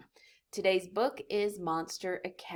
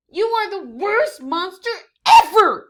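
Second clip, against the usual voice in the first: very loud, much higher yell; furious shout; 11 semitones higher, 16 dB louder than usual. Acted. Fifi splattered by Joe, furious yelling. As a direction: scream it at him.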